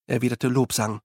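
ordinary delivery, nothing marked

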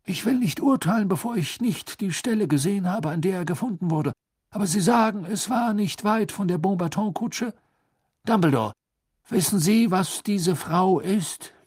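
high-pitched pompous minister; dismissive minister. A narrator's reading.

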